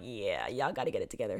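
imitated deep-voiced disapproval; mock stern figure. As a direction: drop deep into a dismissive mock scolding. Mock stern Jesus, contrasted with forgiving.

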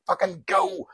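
very high-pitched mock shout; starting-gun yell. Belfast Mass sent up for communion, mock shouting. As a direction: yell it like launching a free-for-all. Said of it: "And now communion. It's like".

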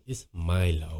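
very low imitation. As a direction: drop "Milo" way down as "MY-lo". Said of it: "Very low".

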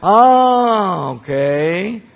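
high, loud drawn-out oh okay; mock surprise. Preacher mocking the excuse with a loud drawn-out oh okay. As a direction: feign big surprise.

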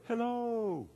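high, soft distant echo. Acted. The canyon answers the son, distant echo. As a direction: send it back faint and high, like a canyon echo. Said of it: "all of a sudden he heard".

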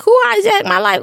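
high, quick mock-indignant question; indignant confusion. Retelling her prayer to God, playfully indignant and baffled. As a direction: replay your own baffled protest to God. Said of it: "'Cause I was like".

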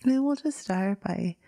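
drifting into whispers between long pauses; guided meditation. Acted. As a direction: ease listeners gently into stillness.

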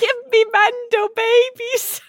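high, slow, pleading mock voice; imitating a lovesick pursuer calling after her; mock clingy companion. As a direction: ham up a lovesick pursuer begging. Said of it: "chasing me around the ship saying".

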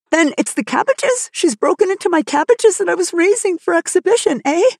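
high-pitched, agitated questioning; alarmed. A narrator's reading. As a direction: jump in with rising alarm. Farmer alarmed about prized cabbages.

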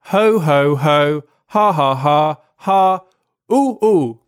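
deliberate ho-ho-ha belly laugh, climbing at the end; read-out fake laughter. A narrator's reading. Reading the soldiers' amusement aloud as fake laughter. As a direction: spell out each laugh as a separate beat. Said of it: "really amuses everyone. I quote".

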